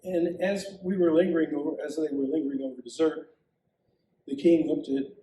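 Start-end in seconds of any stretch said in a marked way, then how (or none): none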